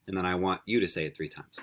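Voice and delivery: ordinary talk, nothing marked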